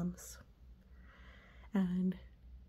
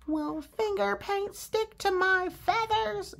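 high, fretful bird voice; worried cartoon pigeon asking; 10 semitones higher, slower than usual. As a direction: play the anxious pigeon, pitched up. Adult voices Pigeon in story.

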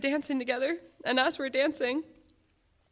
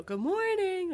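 strong morning greeting; 10 semitones higher, slower than usual. Retold or imitated. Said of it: "his voice was strong".

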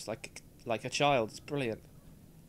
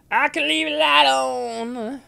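mock child voice; 9 semitones higher, 12 dB louder than usual. Child voice answer, high.

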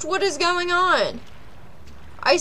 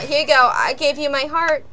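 ordinary talk

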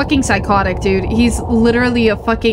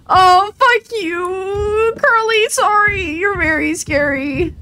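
high, drawn-out tearful whine; 9 semitones higher, slower than usual. Real, not acted. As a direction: wail it through threatening tears. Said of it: "crying over this".

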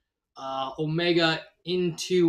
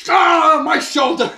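high, loud mock yelp of pain; hammed-up scream over a hurt shoulder; pained cry; 12 semitones higher, 12 dB louder, slower than usual. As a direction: cry out as if just shot. Hurt character cries out.

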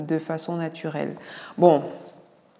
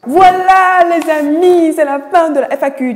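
excited announcement; 10 semitones higher, 11 dB louder than usual. Real, not acted. Doctor wrapping up her FAQ for viewers, excited and loud. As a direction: sing out the end of the FAQ with delight.

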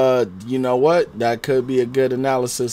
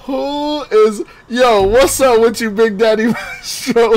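high, loud, slow and giddy; laughing hype at a donor's name; hyped laughing greeting; 9 semitones higher, 3 dB louder, slower than usual. Real, not acted. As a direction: stretch it out, cracking up.

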